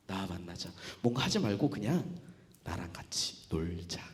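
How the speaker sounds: hushed, low, tender invitation; gently soothe, sinking into a near whisper; soft and intimate, with long pauses; Jesus reassuring. Acted. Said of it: "예수님이 저에게 이렇게 말씀하셨어요".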